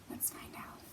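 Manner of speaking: secret whisper. Acted. Teacher whispers to build suspense for kids. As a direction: lean in and whisper it. Teasing the next verse.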